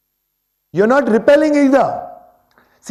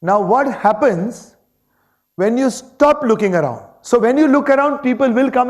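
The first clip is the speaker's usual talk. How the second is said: usual speaking voice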